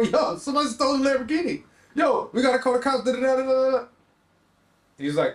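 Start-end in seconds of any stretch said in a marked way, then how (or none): 0.0-3.8 much higher, urgent and rushed; frantic retold call. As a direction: relive the panic on the phone. Frantic call about stolen car.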